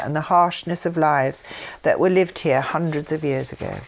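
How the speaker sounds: calm formal reading of a written text; reading aloud. Acted. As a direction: read the document steadily, like a formal statement.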